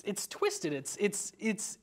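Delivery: ordinary talk, nothing marked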